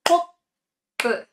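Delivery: kopu said with a held gap after ko; pause in kopu. Shown as a demonstration. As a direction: stop briefly after ko in kopu, then release pu. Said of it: "pra dar essa pausa".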